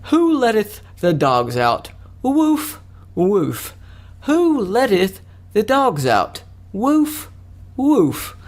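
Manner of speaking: slow, high theatrical declamation; hammed-up old-time stage bard with high, hooting woofs; Shakespeare. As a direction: declaim it grandly, stretching the words. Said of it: "do my impression of Shakespeare".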